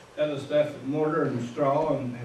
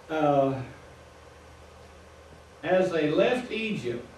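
ordinary delivery

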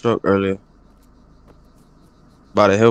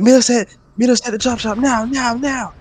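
high, pushy, rushed summons; mock urgent gang call; 13 semitones higher than usual. Retelling the crew ordering him to the chop shop, high mock urgency. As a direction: imitate them hurrying you to the chop shop. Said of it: "They're like".

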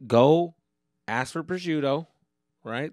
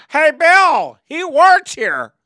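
much higher, loud, slow call to Bill; mock golfer calling out; 14 semitones higher, 19 dB louder, slower than usual. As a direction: hollers across the green like a snob. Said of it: "golfer calling friend".

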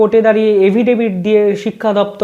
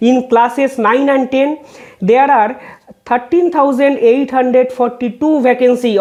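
reading a court order word by word, very slow; slow, measured legal read-out; slow formal reading; 3 semitones higher, much slower than usual. Acted. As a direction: spell out each figure deliberately for the record. Reads vacancy figures from judgment.

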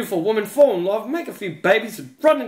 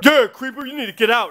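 louder, higher mock-tough guard; lightly voiced bullying security guard; Macho Man-style guard; 5 semitones higher, 3 dB louder than usual. Security guard ordering a customer out, imitated gruff bluster. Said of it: "trying to do his best Macho Man impersonation".

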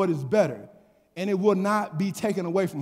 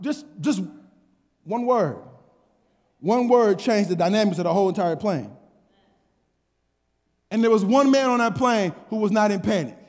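ordinary delivery

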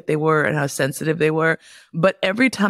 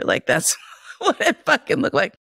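laughing; 9 semitones higher than usual. Real, not acted. Laughing at her own story.

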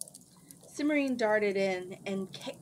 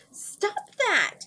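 much higher, louder cry; urgent shout; 13 semitones higher, 7 dB louder than usual. A narrator's reading. Alienora shouts at the wizard aiming his staff. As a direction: stop him before he fires. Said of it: "Alienora said".

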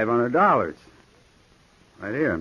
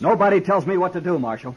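defiant; 10 semitones higher than usual. Acted. Gunman defying the marshal.

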